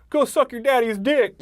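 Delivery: put-on high, slow jeering kid voice; mocking Richie taunt. Richie mouthing off at Henry, high mocking taunt. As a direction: sneer it up high and drawn out like a bratty kid. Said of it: "and then Richie says".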